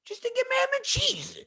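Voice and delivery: very high exasperated outburst; mock impatient squawk; impatient father. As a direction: strain it out like a fed-up dad. Father losing patience, retold.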